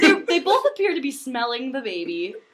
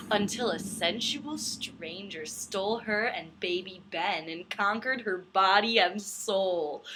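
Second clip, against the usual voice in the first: slow, dramatic back-cover read; blurb reading; 4 semitones lower, 5 dB quieter than usual. Acted. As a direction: read the synopsis like a movie trailer. Reads book blurb aloud.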